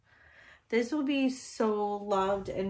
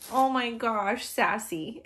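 ordinary talk, nothing marked

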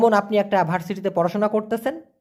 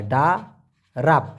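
slow demo; 5 semitones lower, much slower than usual. Shown as a demonstration. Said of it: "ডারাব কথাটিও".

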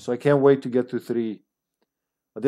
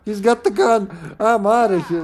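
higher, louder mock panic; 10 semitones higher, 5 dB louder than usual. Player flees the armed policeman in higher, louder mock panic. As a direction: squeal it out like you're fleeing a gun.

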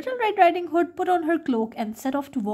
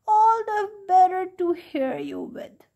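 high fake granny voice of the wolf; wolf as grandma; 8 semitones higher than usual. A narrator's reading. Wolf in grandma's bed answers the girl in a high fake granny voice. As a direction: pitch it up, sly and sweet. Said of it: "said the wolf".

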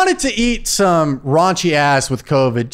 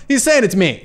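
usual speaking voice

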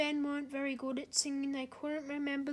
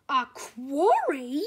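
slow, much louder startled question; a diesel shocked to hear about a quarry; surprised; 13 dB louder, slower than usual. A narrator's reading. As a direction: blurt it out in disbelief. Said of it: "said Salty in surprise".

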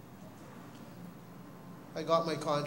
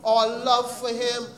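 preaching at full force; 5 semitones higher than usual. Acted. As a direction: drive it home to the congregation. Exhortation to congregation.

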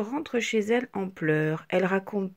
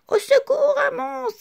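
much higher, louder scream; terrified cry; 7 dB louder than usual. A narrator's reading. The shapes flee the monster screaming, much higher. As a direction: shriek in fright. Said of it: "s'écrient les petites formes".

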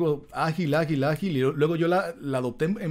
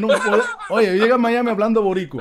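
laughing while talking, higher and louder; 7 semitones higher, 6 dB louder than usual. Real, not acted. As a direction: laugh through the words about the accent. Laughing about his acquired accent.

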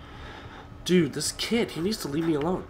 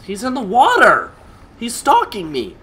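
high alarmed shouting; panicked shout; 8 semitones higher, 11 dB louder than usual. Real, not acted. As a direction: cry out in fear.

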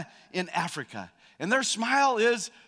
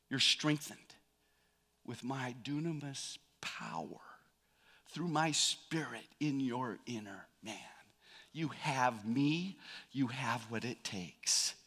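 soft, slow, hushed reassurance; tender voice of God; 5 semitones lower, 10 dB quieter than usual. Acted. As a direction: speak it like a father comforting his son. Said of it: "And this is his answer".

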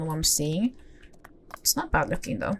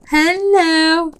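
high, raised calling voice; excited calling out; 8 semitones higher, 5 dB louder than usual. Real, not acted. Player calling the new horse back, high excited call. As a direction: call eagerly to an animal wandering off.

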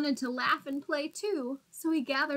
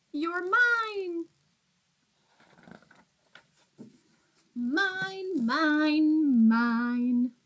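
sing-song mine refrain; 4 semitones higher, 4 dB louder, much slower than usual. Acted. Happy greedy refrain ending story.

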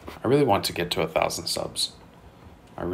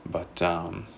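normal talking voice

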